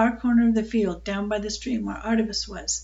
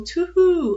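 drawn-out, higher-pitched hoot; mournful owl hoot; 8 semitones higher, 6 dB louder than usual. A narrator's reading. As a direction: imitate an owl's mournful call. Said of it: "the mournful".

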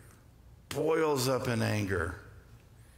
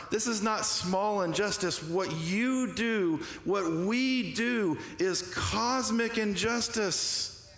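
rising preaching climax; 8 semitones higher, slower than usual. Real, not acted.

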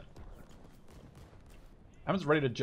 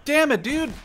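high loud angry outburst; frustrated yell; 13 semitones higher, 11 dB louder, slower than usual. Real, not acted. Gamer yells at teammate after dying, frustrated. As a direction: vent at getting killed again. Killed again in game.

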